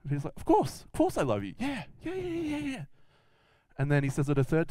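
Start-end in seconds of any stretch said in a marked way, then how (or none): 0.4-2.8 high, squeaky eager insistence; over-eager reassurance. Acted. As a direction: gush the answer, climbing higher on each yeah. Peter eagerly answers Jesus second time.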